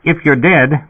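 a dispatcher crackling through the speaker; sputtering intercom voice. A narrator's reading. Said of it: "the speaker sputtered".

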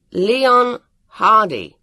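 loud, drawn-out call of a name; slow, high, emphatic summons. A narrator's reading. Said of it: "he said loudly".

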